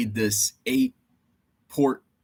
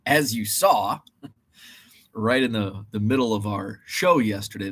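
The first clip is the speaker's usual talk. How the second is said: usual speaking voice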